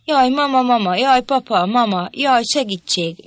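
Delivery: high, loud panicked cries for mom and dad; frightened screaming. Acted. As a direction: scream for help like terrified children. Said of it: "valami hasonlót kiabáltak".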